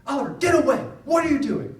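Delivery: high, loud imitation of an angry kid; angry yelling. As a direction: yell at a little brother. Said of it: "he sounded so angry".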